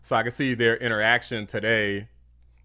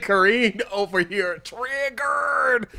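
laughing, slow, higher mocking jab; laughing mockery; 8 semitones higher, slower than usual. Real, not acted. Commentator laughing at press secretary, mocking. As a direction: laugh at her as you say it.

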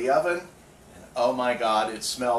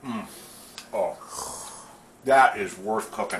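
talking through a bite of stuffing; chewing while talking; 3 dB louder than usual. Real, not acted. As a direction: mouth full of food. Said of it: "sorry, I'm trying to eat a sample here".

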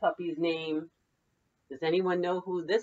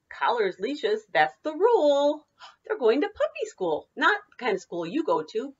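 lively singsong picture-book read; bouncy read-aloud for children; 5 semitones higher, 5 dB louder than usual. Acted. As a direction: read it like story time for little kids. Teacher reads picture book aloud.

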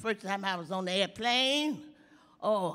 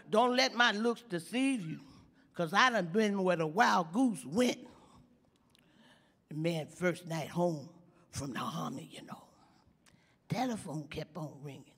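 usual speaking voice

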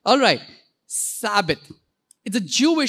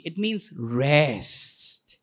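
low, very slow and soft; restful; 7 semitones lower, 5 dB quieter, much slower than usual. Shown as a demonstration. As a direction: let the word rest sink down slowly. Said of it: "say it in a restful way".

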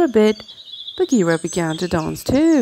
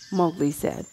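plain reading voice

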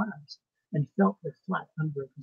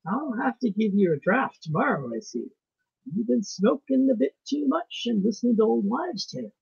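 higher, louder Irish voice; Irish doctor; 7 semitones higher, 5 dB louder than usual. A narrator's reading. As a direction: scoff heartily in an Irish accent. Irish doctor laughingly dismisses the narrator.

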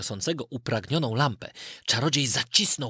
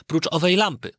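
usual speaking voice

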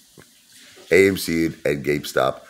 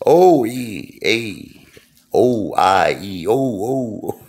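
Italian mafia impression; 5 semitones higher, much slower than usual. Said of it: "You know who I'm imitating?".

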